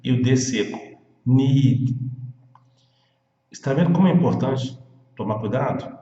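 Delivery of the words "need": Need with a long ee and a dry D; Need ending on a dry D. Shown as a demonstration. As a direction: stretch the ee in Need, stop dead on D. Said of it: "o detalhe do I, que é longo".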